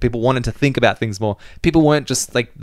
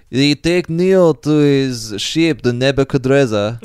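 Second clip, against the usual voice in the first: put-on Russian accent; 3 semitones higher, slower than usual. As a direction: read it like a Russian narrator. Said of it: "in his Russian accent".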